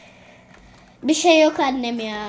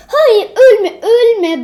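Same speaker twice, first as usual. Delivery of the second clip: much louder, higher begging; desperate plea; 8 semitones higher, 11 dB louder, much slower than usual. Real, not acted. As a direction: beg the character not to die. Pleading with character.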